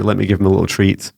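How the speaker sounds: usual speaking voice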